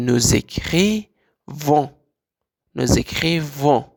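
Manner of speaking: "nous écrivons" stretched slowly, the z clearly joining the words; slow model of "nous écrivons" with a z link; liaison demo. Shown as a demonstration. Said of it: "آواز ز".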